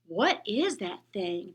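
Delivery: put-on a kid's slow scornful sneer. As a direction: drag it out with contempt. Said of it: "the boy beside him sneered".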